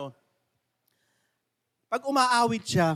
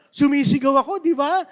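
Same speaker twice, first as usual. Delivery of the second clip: Mamberto shouting; 7 semitones higher, 9 dB louder than usual. Retold or imitated. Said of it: "Sabi niya".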